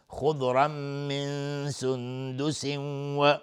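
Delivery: very slow recital of خضرا من سندس و with held nasal hums; ikhfa and idgham. Shown as a demonstration. Teacher shows the nun rules in خضرا من سندس و, slow held hums. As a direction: merge and hold each nun sound in خضرا من سندس و as a hum. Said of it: "نون ساکن بعدش سین اخفای حقیقی".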